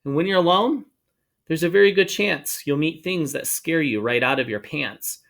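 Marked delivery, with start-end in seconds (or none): none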